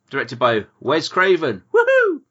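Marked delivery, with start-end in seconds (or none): 1.7-2.2 high, loud whoop; excited cheer. Real, not acted. Cheering the director credit.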